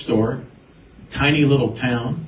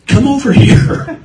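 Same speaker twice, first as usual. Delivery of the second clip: urgent call aside; 11 semitones higher, 10 dB louder than usual. Retold or imitated. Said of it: "He goes".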